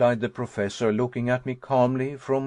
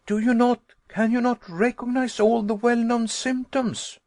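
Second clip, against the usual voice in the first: high, loud, insistent; agitated pleading; 11 semitones higher, 4 dB louder than usual. Acted. Nephew pressing uncle to admit the danger, high and urgent. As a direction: beg him to see the danger.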